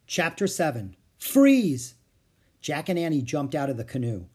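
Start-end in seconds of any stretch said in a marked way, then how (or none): 1.2-1.9 high, loud exclamation; loud command. A narrator's reading. Narrator announces the chapter title, loud command. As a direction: call it out sharply.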